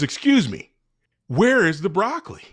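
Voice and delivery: hammed-up impatient shopper question; insistent customer. Lady pesters the produce guy about broccoli, insistent.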